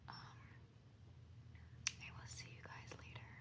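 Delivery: hushed, very soft whisper; whispered vlog. Real, not acted. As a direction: whisper it like a quiet just-woke-up update. Whispers morning update before work.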